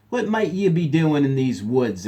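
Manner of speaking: a rough stranger barking a question; gruff voice. A narrator's reading. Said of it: "asked a gruff voice".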